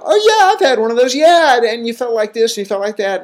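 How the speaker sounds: high, bright-eyed mock enthusiasm fading at the end; mock chipper know-it-all. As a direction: play the eager clinician who finally gets it. Inner clinician relating to patients.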